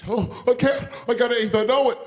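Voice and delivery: high, loud despairing voice; panicked whining. Acted. Hit passenger fearing infection, high panicked wail. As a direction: wail it, convinced the worst has happened.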